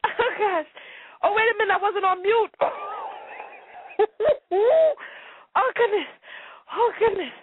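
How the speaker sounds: high voice, still weak with laughter; recovering from laughing fit. Real, not acted. Host finding the mute button failed, high and laughing apologetically. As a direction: apologize while barely holding back laughter. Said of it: "needed a moment to pull myself together".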